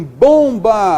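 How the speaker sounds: "bomba" loud and slow, "bom" held; stressed nasal syllable. Shown as a demonstration. Teacher showing a nasal tonic, "bomba" loud with long "bom". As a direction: punch and stretch the "bom" of "bomba". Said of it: "a tônica é nasal".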